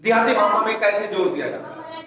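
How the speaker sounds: ordinary talk, nothing marked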